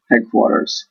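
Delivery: ordinary delivery, nothing marked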